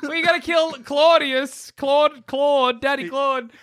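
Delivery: overacted death scene. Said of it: "I die and become a ghost".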